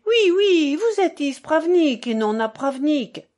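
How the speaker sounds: high, loud calling after someone; crying out. A narrator's reading. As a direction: shout it urgently, pitch well up. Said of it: "Je courus après lui en criant".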